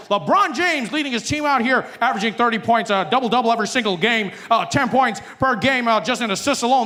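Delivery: high, hyped arena announcer; sports announcer. Retold or imitated. Comedian mocking NBA broadcast, high hyped announcer. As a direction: sell it like courtside play-by-play.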